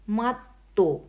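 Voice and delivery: "Matto" with a held double t; careful Finnish word. Shown as a demonstration. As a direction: present "Matto" clearly for the class. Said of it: "means carpet".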